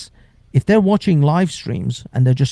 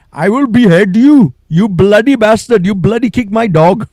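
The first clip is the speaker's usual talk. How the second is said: higher, louder hammed-up Pakistani accent; 7 semitones higher, 7 dB louder than usual. Chat troll yelling insults at the streamer in a Pakistani accent. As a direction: shout it in a thick mock Pakistani accent. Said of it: "If he's Pakistani, it'd be a lot more like".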